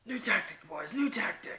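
high, urgent order to the gang; rally the goblins with a mock command; goblin leader's call. Player voices goblins changing plan.

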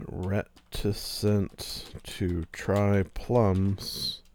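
very slow, savoring each word; slow title read. Shown as a demonstration. Host testing a podcast title, very slow. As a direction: try the phrase out slowly as a title. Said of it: "I do like that as a titleable".

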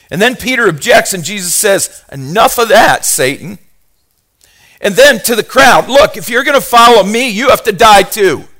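loud, high fervent preaching; preaching at full force. Acted. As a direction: drive it home to the congregation.

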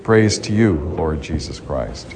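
ordinary talk

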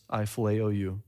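quiet, low, humbled reply; humbled whisper-soft answer. Acted. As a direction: Peter bowing his head, barely audible. Peter answers a third time.

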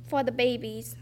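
usual speaking voice

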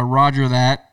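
muffled as if through a mask; talking through gas mask. Pretend. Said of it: "so it sounds like I'm talking through my mask".

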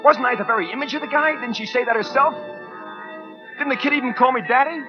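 high-pitched, insistent rhetorical questions; agitated self-justification. Acted. Argues himself into it, agitated.

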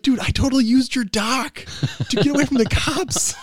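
thrilled to confess; gleeful confession. Retold or imitated. Telling Dave he used his dock, loud and high. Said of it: "And I was like".

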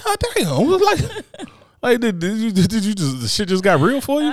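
high, laughing mock amazement; laughing mockery. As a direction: tease her through laughter. Mocking her cluttered house.

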